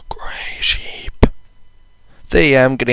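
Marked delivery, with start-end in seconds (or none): 0.1-1.3 hushed whisper; awed whisper. Real, not acted. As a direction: whisper it in awe. Spots a rare grey sheep.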